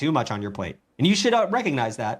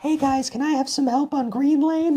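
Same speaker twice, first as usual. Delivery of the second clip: put-on high, slow girly voice, much higher; sweet girl asking teammates for help; girl gamer voice; 13 semitones higher than usual. A gamer imitates a girl asking teammates for help, high and sweet. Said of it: "a girl gets on the mic like".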